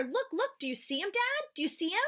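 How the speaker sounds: high, eager calling out; boy begging dad to see the fish; excited. Acted. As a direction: urge dad to look, thrilled.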